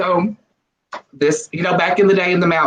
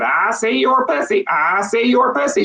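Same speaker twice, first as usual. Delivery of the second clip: higher, slow, sing-song parrot; a cheeky parrot taunting, drawn out; 8 semitones higher, slower than usual. Acted. Parrot taunting the old woman in a put-on voice.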